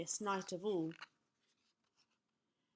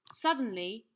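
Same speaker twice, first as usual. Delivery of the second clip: usual speaking voice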